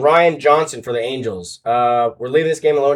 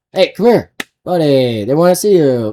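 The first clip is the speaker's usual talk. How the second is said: raised voice coaxing a pet; calling over; 5 dB louder than usual. Real, not acted. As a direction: call the reluctant buddy to come. Coaxing his pet on camera.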